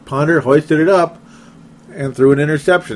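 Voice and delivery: usual speaking voice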